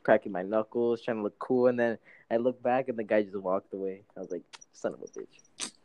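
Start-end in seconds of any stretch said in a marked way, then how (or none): 4.7-5.7 low, quiet mock grumble; grumbling curse. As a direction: mutter it low under your breath. Retold grumble as rival leaves.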